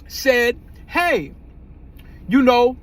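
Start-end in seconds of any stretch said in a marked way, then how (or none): none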